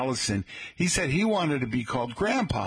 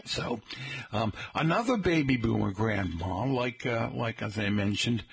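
ordinary talk, nothing marked

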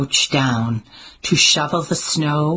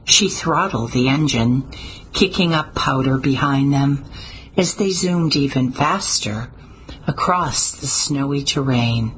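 plain reading voice

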